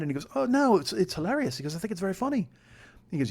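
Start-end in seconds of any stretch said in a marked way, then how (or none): 0.3-1.6 high-pitched mock English accent; Paul. Flatmate reassuring the podcaster, high put-on English accent. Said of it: "your English accent is shit". 1.7-2.5 high imitated English accent; Paul. Flatmate reassuring the podcaster, put-on English accent. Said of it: "your English accent is shit".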